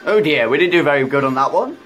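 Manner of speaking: ordinary delivery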